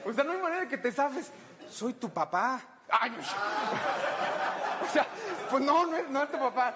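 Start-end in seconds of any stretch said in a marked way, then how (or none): 3.2-4.8 burst of laughter. Real, not acted. Laughing at own joke.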